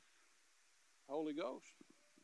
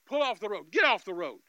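loud gunman's order; retold loud gunman ordering the driver off the road; barked order; 6 semitones higher, 13 dB louder than usual. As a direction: snap it at him like a robber. Robber orders him off road.

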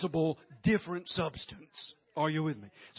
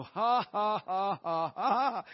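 loud, deliberate ha-ha building higher; forced laugh; 4 semitones higher, 9 dB louder, slower than usual. Shown as a demonstration. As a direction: prime the laugh until it starts to catch. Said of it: "you might have to do that".

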